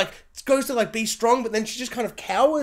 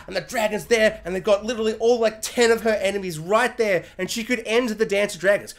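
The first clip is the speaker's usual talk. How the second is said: ordinary delivery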